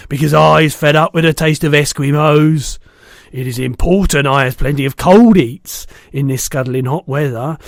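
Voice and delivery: a big brute bragging loudly; loud giant. A narrator's reading. Bloodbottler mocking the BFG, loud and boastful.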